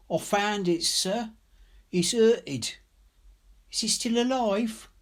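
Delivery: put-on high, timid child's voice, pitched well up; a scared twelve-year-old pleading for her bird; frightened little girl. A narrator's reading. Narrator voicing the scared girl, high and timid.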